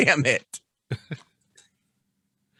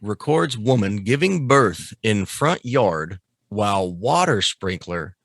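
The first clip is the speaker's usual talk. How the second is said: slow headline read; slower than usual. Shown as a demonstration. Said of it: "reads headline aloud".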